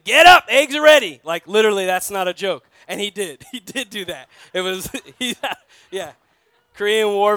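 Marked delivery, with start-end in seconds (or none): 0.0-1.2 loud, high barked wake-up call; grandfather's shout. Retold or imitated. Grandfather kicking open the door, shouting at sleeping boys. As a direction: yell it like a veteran storming into the bedroom.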